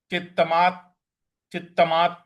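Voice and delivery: चित्तमात with slight weight on the half त; light stress. Shown as a demonstration. Teacher showing the class light stress on चित्तमात. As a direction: give चित्तमात a gentle push on त्त. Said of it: "हल्का सा बल लगाना है".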